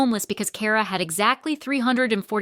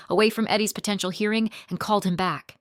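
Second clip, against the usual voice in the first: ordinary delivery, nothing marked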